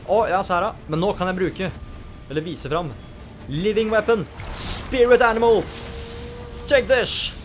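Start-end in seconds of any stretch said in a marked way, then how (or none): 3.5-7.3 high, loud hyped-up battle cries ending in a shout; triumphant hype. Real, not acted. Gamer showing off his super attack, loud triumphant battle cries. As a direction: roar it out like unleashing a finisher.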